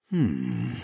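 low, falling "hmm" sigh; sighing hum. A narrator's reading. Slothman answering a touchy question, displeased sigh. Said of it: "Ozzy sighed with a frown".

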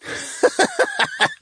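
high, breathy run of laughter; merman's mocking laugh. A narrator's reading. Captive merman amused by the needle question, laughing. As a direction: laugh at the very idea. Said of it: "he laughed".

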